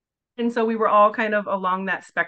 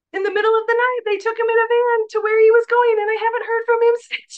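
incredulous; 14 semitones higher, 6 dB louder than usual. Retold or imitated. Retelling shocking drop-off.

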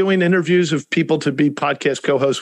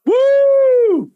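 loud high cheering whoop; excited whoop; 5 dB louder than usual. Real, not acted. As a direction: celebrate with a big woo. Celebrating the story's ending.

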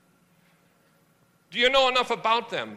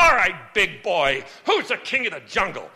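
blustering lion; 6 dB louder than usual. Acted. Lion challenges elephant.

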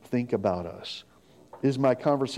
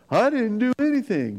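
put-on high, loud whining child; mock child voice, pitched way up; whiny kid protest; 10 semitones higher, 4 dB louder than usual. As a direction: protest your innocence like a caught kid.

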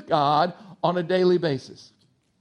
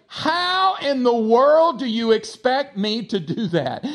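hammed-up, higher, slow exasperation; incredulous protest; 4 semitones higher, slower than usual. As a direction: object in drawn-out mock disbelief. Acting out people's incredulous objection.

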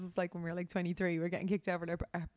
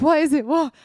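high, louder nervous what is it; panicked innocence; 6 semitones higher, 11 dB louder than usual. Retold or imitated. Caught out by the manager, nervous denial. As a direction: play dumb, panicking. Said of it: "And I was like".

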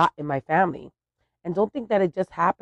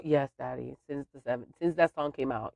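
ordinary talk

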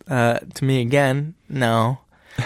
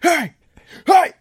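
high mock yell hey; imitated road-rage yell; 10 semitones higher, 3 dB louder, slower than usual. Retelling an angry driver yelling at the car ahead. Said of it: "he's like".

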